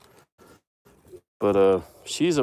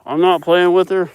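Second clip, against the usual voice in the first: higher, louder defensive plea; loud protest; 9 semitones higher, 11 dB louder than usual. Real, not acted. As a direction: protest innocence loudly. Pleading with charging cow.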